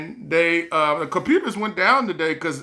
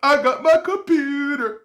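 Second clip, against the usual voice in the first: mock celebration, pitched way up; happy cheer; 7 semitones higher than usual. Cheering to himself after buying the computer, high excited whoop.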